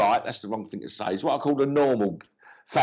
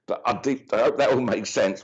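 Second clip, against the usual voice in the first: ordinary delivery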